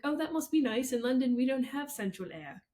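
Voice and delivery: lightly put-on London accent. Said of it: "in their little London accent".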